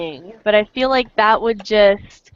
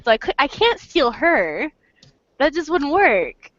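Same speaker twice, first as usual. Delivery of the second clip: ordinary talk, nothing marked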